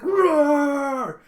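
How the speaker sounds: high, loud, long mock roar; battle roar. Girl powers into scribbling at her desk, roaring. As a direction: roar with all-out determination, hammed up.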